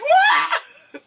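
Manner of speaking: high squealing "wah"; high-pitched mock scream; re-enacted theater scream. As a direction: imitate a fan's overjoyed screech. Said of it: "I have not screamed in a theater".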